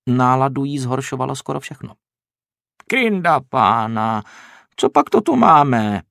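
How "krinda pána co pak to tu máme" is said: slow, easygoing farmer voice; unbothered, drawn out greeting; calm rustic farmer, louder and slow. A narrator's reading. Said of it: "řekl farmář klidně".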